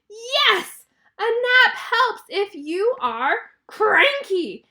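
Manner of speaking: loud, high, slow exasperated yell; frustrated yell. Acted. Elephant snaps at Piggy for interrupting his sleep, frustrated yell. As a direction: yell it word by word, drawing out the last one. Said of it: "and he yells".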